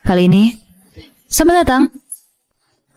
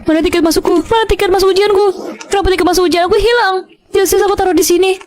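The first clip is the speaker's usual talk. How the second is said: higher, frantic questions; panicked search; 8 semitones higher than usual. Acted. Girl searching her room for exam ticket, panicked. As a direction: hunt for the ticket in a rising panic.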